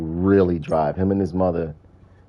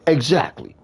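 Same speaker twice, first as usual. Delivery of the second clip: higher put-on Fred voice; a hint of a high imitated Fred; Fred insisting; 8 semitones higher than usual. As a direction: smugly insist. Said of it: "He's like".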